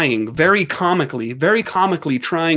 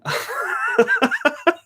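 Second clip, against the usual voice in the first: high burst of laughter; 14 semitones higher than usual. Real, not acted. Laughing at her own dog voice. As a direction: laugh out at the joke.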